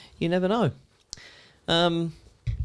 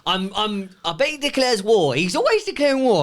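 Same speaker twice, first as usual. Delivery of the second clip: imitating the boss; 6 semitones higher than usual. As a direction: ham up the blustering boss declaring war. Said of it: "When he says about stress".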